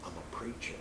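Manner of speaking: hushed, voiceless whisper. Retold or imitated. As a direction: whisper it secretly into an ear. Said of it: "I whispered in the ear".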